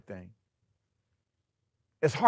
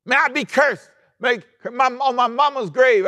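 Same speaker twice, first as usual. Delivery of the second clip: loud, much higher desperate oath; swearing oath; 10 semitones higher, 5 dB louder than usual. Acted. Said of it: "and he swore".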